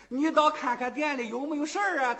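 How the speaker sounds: high-pitched, impatient query. A narrator's reading. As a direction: call in with hurried impatience. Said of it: "他也是个急劲儿啊".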